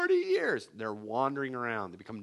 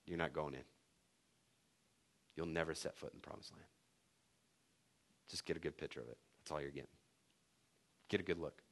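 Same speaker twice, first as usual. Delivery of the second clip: much softer, low and stern; God's stern ruling; 8 semitones lower, 13 dB quieter, faster than usual. Retold or imitated. God refusing Moses entry, soft stern verdict. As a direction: quietly close the door on him. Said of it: "And God says".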